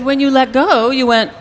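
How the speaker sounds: a touch of an excited mom; mother's voice. Retold or imitated. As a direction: play the amused mother telling the story. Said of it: "she said".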